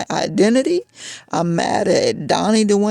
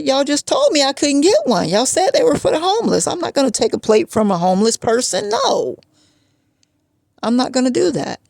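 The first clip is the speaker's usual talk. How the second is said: higher, fast exasperated retort; indignant refusal; 6 semitones higher than usual. Retold or imitated. She refuses the homeless plate, high exasperated retort. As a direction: throw it back at him, pitched up.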